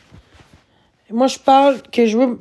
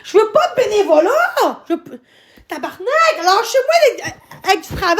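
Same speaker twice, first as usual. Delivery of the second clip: angry rant; 7 semitones higher than usual. Real, not acted. Streamer angrily rants at viewers.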